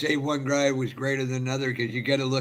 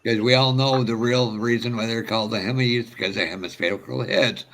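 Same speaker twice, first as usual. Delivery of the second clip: usual speaking voice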